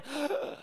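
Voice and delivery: mock sharp gasp of outrage; imitated gasp; shocked gasp. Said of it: "'Cause everyone's going".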